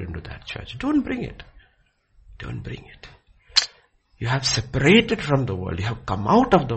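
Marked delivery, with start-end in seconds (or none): 0.8-1.4 high, hard Don't bring it; emphatic command. Real, not acted. Emphatic repeat contrast. 2.4-3.1 Don't bring it breathed unvoiced and soft; whispered echo. Real, not acted.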